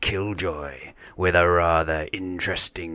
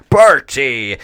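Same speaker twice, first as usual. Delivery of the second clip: higher and louder Party!; party shout; 6 semitones higher, 11 dB louder than usual. Acted. Captain rousing his crew, loud excited Party!. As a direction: yell Party! at full voice.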